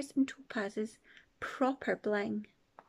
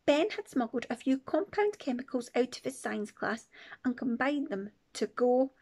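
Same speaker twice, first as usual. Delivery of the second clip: plain reading voice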